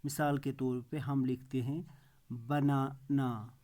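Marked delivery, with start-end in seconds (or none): 2.3-3.6 banana drawn out slowly with a break before the last ना; syllable by syllable. Shown as a demonstration. Teacher giving an example of syllable division, syllable by syllable. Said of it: "जितनी बार उस words को बोलने में".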